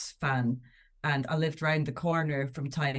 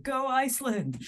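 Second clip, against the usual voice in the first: a high, hammed-up sports cheer; mock cheer; 8 semitones higher than usual. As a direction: cheer on the other team as a tease. Mock cheering for the rival team.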